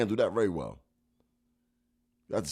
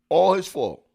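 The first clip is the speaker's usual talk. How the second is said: emphatic repeat; 6 semitones higher, 5 dB louder, slower than usual. Real, not acted. Repeats the phrase with more force.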